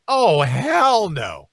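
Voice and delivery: loud, with HELL stretched long; milk the word hell, slow and loud; emphatic drawn-out refusal. Pretend. Deliberate repeat after slip, hammed up.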